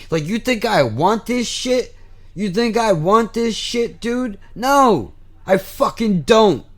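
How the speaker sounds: real indignant outburst at being judged; passionate yelling. Real, not acted. Ranting at those who judge his drug use, real passionate anger. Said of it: "I get passionate".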